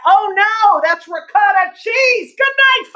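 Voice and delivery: high, loud comic alarm building to sign-off; hammed-up punchline. Comedian closes her street-accident bit, hammed-up loud sign-off. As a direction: throw the punchline out big and high.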